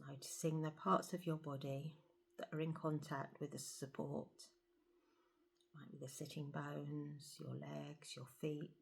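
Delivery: soft, hushed meditation voice with long pauses; guided meditation. Acted. Guide leading listeners to feel the breath and body, guided meditation. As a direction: settle them gently into stillness, softly.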